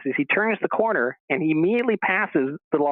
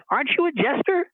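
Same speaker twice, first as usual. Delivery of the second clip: calling out; 12 semitones higher than usual. Retold or imitated. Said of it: "he calls out to the guy".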